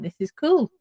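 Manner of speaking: bright, mock-excited missionary line; mock enthusiasm. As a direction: sell it like an eager missionary. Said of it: "You were saying".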